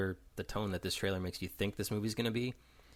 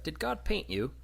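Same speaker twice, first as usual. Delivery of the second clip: put-on high, innocent child's question; imitated little kid; 8 semitones higher than usual. Kid going up to Morgan Freeman, high and innocent. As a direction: ask it like a curious little kid. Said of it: "the kid goes up to Morgan Freeman".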